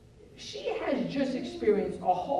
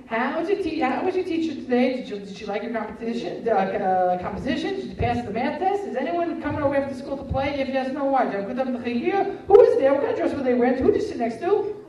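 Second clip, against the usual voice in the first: loud, raised-pitch barrage of questions; rapid-fire questioning; 5 semitones higher, 8 dB louder than usual. Acted. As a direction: pepper the child with questions, one after another. Acted parent firing questions at child.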